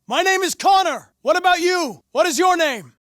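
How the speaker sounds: high, loud calling out; calling out to a hiding deviant. Acted. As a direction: raise the voice to reach him. Calling out to a frightened android.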